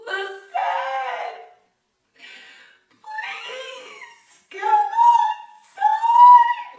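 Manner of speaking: very high, drawn-out wailing shout; hammed-up begging boyfriend, howled up at the window; mock bawling plea. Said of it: "bawling, crying".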